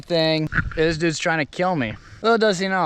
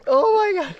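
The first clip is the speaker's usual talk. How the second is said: laughing scream. Real, not acted. Abandoning ship, falling.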